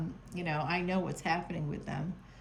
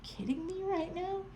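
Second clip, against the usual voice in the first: whispered disbelief; 11 semitones higher, 3 dB quieter than usual. Retold or imitated. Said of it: "And I'm like".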